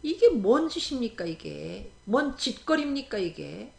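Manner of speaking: ordinary talk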